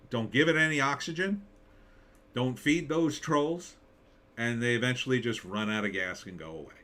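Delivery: ordinary talk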